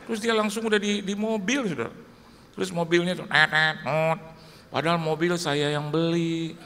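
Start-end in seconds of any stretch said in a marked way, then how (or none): 3.3-4.2 car horn noise. Retold or imitated.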